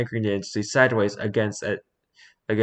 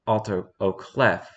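usual speaking voice

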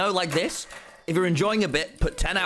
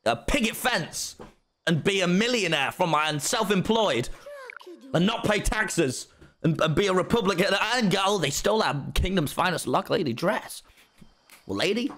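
hammed-up American streamer voice, pitched higher; over-the-top American accent; fake American accent; 3 semitones higher than usual. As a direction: play a cartoonish American host. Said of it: "I need to be less British".

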